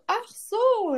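surprised squeak at the news; mock surprise. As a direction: play the stunned, high "oh, I see". Reacts to the girlfriend's revelation.

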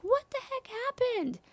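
high, bewildered burst; exasperated what the heck. Retold or imitated. Mom demanding answers from her crying daughter, high and bewildered. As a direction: throw up your hands in confusion. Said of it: "And I'm like".

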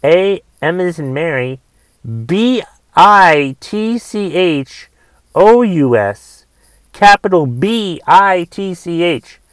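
slow letter-by-letter spelling. Shown as a demonstration. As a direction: spell each letter out slowly. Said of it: "I'm going to spell it for you".

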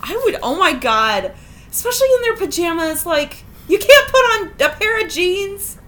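loud, high, incredulous ranting; mock outrage. Host reacting to parents turning up in pajamas, mock outrage. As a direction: draw out the disbelief at full volume.

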